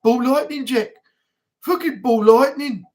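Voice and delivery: impression of Ozzy insisting to Jack; imitating [name]. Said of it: "He goes".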